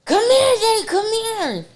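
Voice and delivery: very high, loud pet voice with a laugh; laughing high baby-talk call to her dog. Retold or imitated. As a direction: coax the dog to come.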